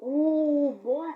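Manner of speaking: loud, high hooting taunt; taunting yell. Retold or imitated. A boy taunting the man with loud hoots. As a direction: hoot it out to provoke him.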